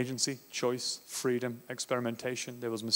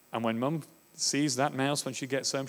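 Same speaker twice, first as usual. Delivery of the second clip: ordinary delivery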